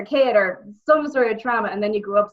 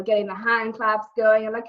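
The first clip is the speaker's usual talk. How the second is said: usual speaking voice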